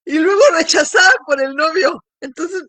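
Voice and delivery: high, loud, voice breaking into tears; crying through a pained laugh. Real, not acted. The bride left by her fiancé, crying through laughter.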